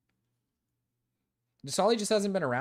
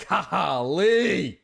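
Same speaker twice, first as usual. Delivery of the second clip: mock astonishment; 6 dB louder than usual. Mock shock at his own offer.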